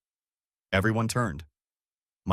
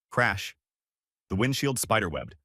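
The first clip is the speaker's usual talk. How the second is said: usual speaking voice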